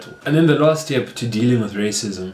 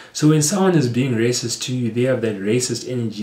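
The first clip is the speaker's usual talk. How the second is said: usual speaking voice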